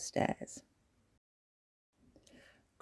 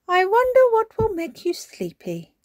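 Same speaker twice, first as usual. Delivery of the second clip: high, loud, musing granny voice; wondering granny; 7 dB louder than usual. A narrator's reading. Granny Pig wondering how to get children sleepy, musing.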